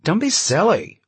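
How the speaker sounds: high, loud, gushing hostess. Acted. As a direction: wave off the thanks brightly.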